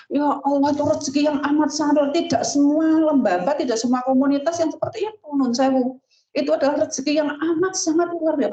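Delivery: high, impassioned sermon voice; fervent preaching. Acted. Preacher marvelling to colleagues at their blessings, high and fervent.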